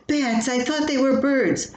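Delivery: the truth sinking in, a bit scared; dismayed surprise. Retold or imitated. Wife dismayed by the bats.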